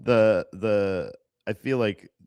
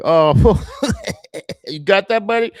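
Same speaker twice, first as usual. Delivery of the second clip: louder, higher mocking jab; teasing smoker; 9 semitones higher, 7 dB louder than usual. Stoner teasing a coughing friend, louder and higher. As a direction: rib them for coughing. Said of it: "They're like".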